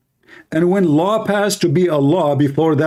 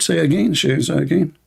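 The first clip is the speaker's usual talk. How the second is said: usual speaking voice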